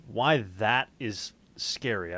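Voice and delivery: normal talking voice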